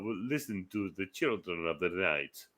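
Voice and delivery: low, soft Dracula impression; Gary Oldman. Dracula quoting the film to friends, low soft impression. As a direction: play the count, hushed and low. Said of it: "I sound like Gary Oldman on that film".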